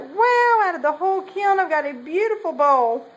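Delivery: high, excited "wow", easing as it goes; happy amazement. Retold or imitated. Potter recalling first sight of the bowl from the kiln, happy amazement. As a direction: relive the thrilled first reaction, pitched well up. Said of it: "I was so happy".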